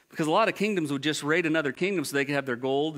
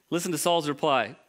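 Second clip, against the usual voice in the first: ordinary talk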